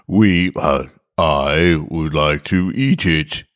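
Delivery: slow, halting gruff disguise; slow gruff fumbling. Acted. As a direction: drag it out like a man faking composure. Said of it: "He said in a gruff voice".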